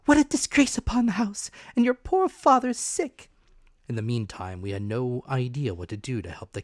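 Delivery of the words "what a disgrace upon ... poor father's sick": high, loud distressed lament; fretting mother. A narrator's reading. Mother laments to Jim, high and loud. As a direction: wail over the house's shame.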